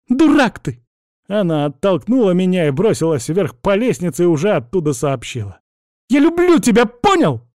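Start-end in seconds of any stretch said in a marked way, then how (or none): 0.1-0.8 high, loud hurled insult; angry outburst. A narrator's reading. Cousin yells in a quarrel. 6.1-7.4 very high, loud, exasperated shout; yelled confession. A narrator's reading. Cousin yells confession from the stairs.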